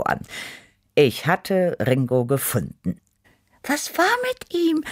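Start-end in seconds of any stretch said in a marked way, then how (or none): 3.6-4.8 very high, thin little cat voice; wispered. A narrator's reading. Tippi the cat asking after Ringo, wispered high. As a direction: wisp it out high and anxious to know. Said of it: "wisperte Tippi".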